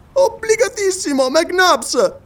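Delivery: high flustered scholar thanking his rescuer; Paganel's voice. Acted. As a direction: gasp out gratitude after the fall. Paganel after falling.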